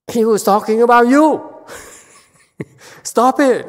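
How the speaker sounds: loud, high yell with a laugh; retold yell. Teacher yelling at a nun, loud and laughing. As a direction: shout it at her, laughing. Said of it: "I yelled at her".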